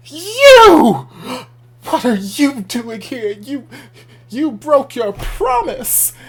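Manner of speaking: very high, loud, drawn-out accusation; hammed-up shriek with long dramatic words; Daddy's telenovela outrage. Daddy confronts someone over a broken promise, melodramatic. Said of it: "it felt very dramatic telenovela".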